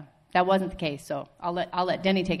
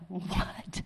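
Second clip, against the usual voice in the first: whisper; 9 dB quieter than usual. Retold or imitated. Whispering in church service.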